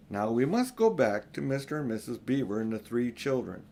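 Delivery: plain reading voice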